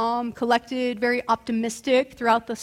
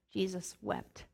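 hushed reading; 5 semitones lower, 10 dB quieter than usual. Acted.

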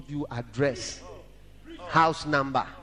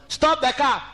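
urgent command; 10 semitones higher, 6 dB louder than usual. Retold or imitated. Divine voice warns.

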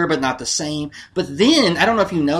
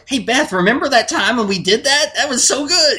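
high, excited mock reminiscing; put-on giddy friend, pitched way up; 10 semitones higher, 4 dB louder than usual. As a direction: gush to a friend in a raised, playful voice. Acting out friends recalling a night.

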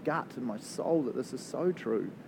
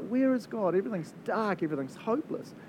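despairing inner voice; 8 semitones higher than usual. Retold or imitated. Pastor voicing a worshipper's dark thoughts, high then fading. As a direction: voice the lost soul sinking into hopelessness.

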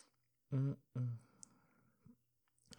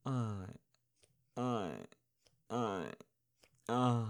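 moaning; 5 semitones higher, 7 dB louder than usual. Acted. Breathless moaning build-up.

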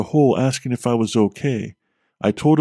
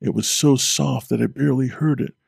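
ordinary delivery, nothing marked

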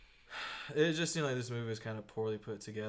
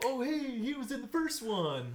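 imitating older fan; 12 semitones higher than usual. Older crowd quote voiced.

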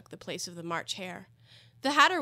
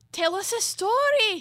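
March Hare voice; 13 semitones higher, 10 dB louder, slower than usual. A narrator's reading.

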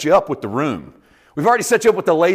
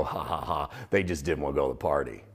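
quiet snickering aside to a friend; a hushed, knowing snicker; mocking aside laugh; 13 semitones lower, 10 dB quieter than usual. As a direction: elbow your neighbour and snicker it quietly. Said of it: "we would elbow each other and go".

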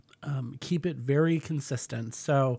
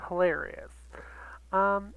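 ordinary talk, nothing marked

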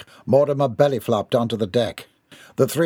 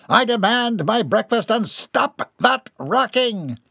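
high, slow, haughty demand; pompous demand; 10 semitones higher, slower than usual. Acted. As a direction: order the servants about like a spoiled heir. Spoiled boy demands breakfast.

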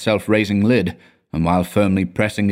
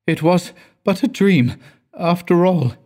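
terrified mutter; 10 semitones higher than usual. Acted. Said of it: "he muttered, still terrified".

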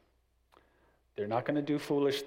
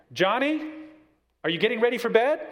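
higher, louder mom calling Johnny; 10 semitones higher, 7 dB louder, faster than usual. Retold or imitated. Mom calls son a second time, louder and higher. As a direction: call again, a bit more insistent. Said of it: "based on that tone of voice".

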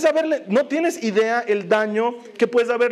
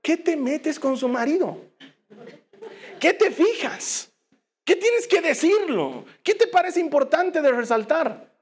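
rebuking gossip; 7 semitones higher than usual. Acted. Preacher scolding the gossip.

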